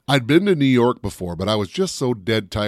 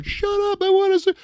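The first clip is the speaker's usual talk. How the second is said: much higher mock whine of an annoyed viewer; annoyed moviegoer; 4 dB louder than usual. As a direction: snap back like someone who just wants the film. Other half of crowd complaining.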